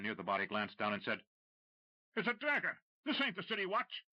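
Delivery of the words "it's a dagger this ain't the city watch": high, loud alarmed cry. Acted. Smuggler alarmed, crying out to the others.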